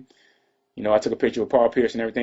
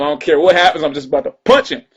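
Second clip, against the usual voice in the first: heated; 4 semitones higher, 7 dB louder than usual. Retold or imitated. Retelling his urge to fight.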